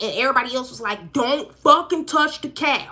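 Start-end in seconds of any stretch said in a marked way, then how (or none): 1.1-2.9 raised, emphatic mock warning; urgent warning. Everybody warning someone not to touch the cat, urgent warning. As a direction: act out a sharp, higher-pitched warning.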